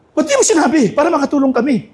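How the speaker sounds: high, surprised voice of a friend who wasn't told; surprised question. Retold or imitated. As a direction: ask it high, a touch hurt you weren't told. Surprised friend asks why not told. (gulat na tanong, mataas ang boses)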